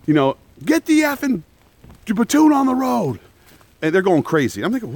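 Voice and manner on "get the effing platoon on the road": high, hammed-up drill yell; maniac screaming. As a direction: yell it like a crazed instructor. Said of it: "They're screaming like maniacs".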